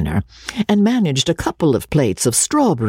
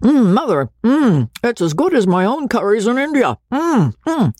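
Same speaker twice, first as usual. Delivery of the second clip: much higher, chewing and humming through food; mouth full; 10 semitones higher than usual. Acted. Joseph at dinner, mouth full, praising the curry to his mother. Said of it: "His mouth was full".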